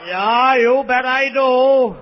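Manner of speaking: loud, slow, drawn-out acceptance; eager mock reply. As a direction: belt out an eager yes, stretching it. Eager answer to lisped come-on.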